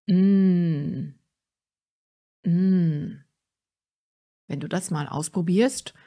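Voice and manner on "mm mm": relaxed, satisfied "mmm" in mid voice; savoring hum. Shown as a demonstration. Coach showing the middle speaking pitch, savoring hum. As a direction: hum as if you just enjoyed a good meal. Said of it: "stelle dir vor, dass du gerade ein gutes Essen genossen hast".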